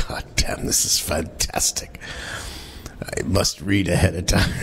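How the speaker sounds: breathless laughing whisper. Real, not acted. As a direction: whisper it through giggles. Laughing whisper after reading.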